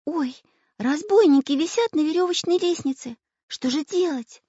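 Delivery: put-on high girlish alarm; high-pitched, frightened little girl; horrified. A narrator's reading. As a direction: gasp out the discovery in fright. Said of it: "в ужасе отшатнулась".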